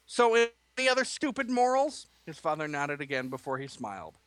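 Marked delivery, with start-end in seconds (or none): 0.1-2.1 high, loud sarcastic jab; angry. Acted. Angry son mocks his father's morals. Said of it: "He was angry now".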